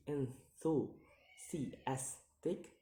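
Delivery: "enthusiastic" drawn out in separate syllables; very slow, split syllables on "enthusiastic". Shown as a demonstration. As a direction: sound out "enthusiastic" piece by piece. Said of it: "count the word syllable backwards".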